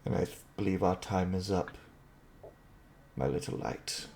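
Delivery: soft, low parting words; father figure's quiet goodbye; hushed farewell. Acted. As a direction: let it fade to a near-whisper. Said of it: "looks you in the eyes and just says".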